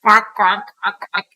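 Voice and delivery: duck quacks in the background; imitating a duck. Background of the old voicemail, mock duck quacks. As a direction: quack like a duck behind the greeting. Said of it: "you hear".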